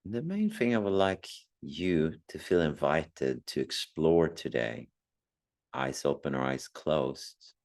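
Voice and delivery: calm meditation guide with long pauses; unhurried meditation voice, phrase by phrase; guided meditation. Acted. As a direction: gently guide listeners inward, leaving long silences.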